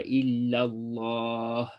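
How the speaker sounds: illallah stretched very slowly, half-sung; slow chanted illallah; elongated illallah. Shown as a demonstration. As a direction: hold the lla of illallah long and melodic. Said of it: "to elongate this dhikr and say".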